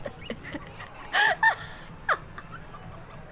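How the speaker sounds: a very high, airless wheeze of laughter; silent laugh. Real, not acted. Wheezing at the high socks in the video. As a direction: laugh without voice.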